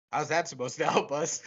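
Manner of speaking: laughing. Real, not acted. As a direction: quote through laughter. Quoting absurd scene, laughing.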